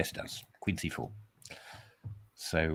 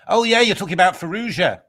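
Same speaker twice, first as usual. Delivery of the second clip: loud, high burst of realisation; excited realisation; 9 semitones higher, 8 dB louder than usual. Real, not acted. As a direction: blurt it out as it dawns on you. Realises which game is meant.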